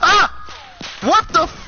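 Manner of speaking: sudden high panicked yell; startled scream. Real, not acted. Teammate panics as enemies breach the wall, startled scream. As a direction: yelp in real fright as shots hit.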